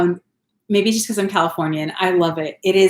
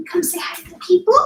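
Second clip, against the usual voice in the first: much higher, fuller sing-song; 11 semitones higher than usual. Real, not acted. Mom asking her son again to greet the people, high sing-song baby talk. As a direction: lift "people" up high and bright.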